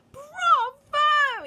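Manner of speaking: very high, loud cheer; yelling crowd. A narrator's reading. Audience of witches cheering, high and loud. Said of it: "yelled the audience".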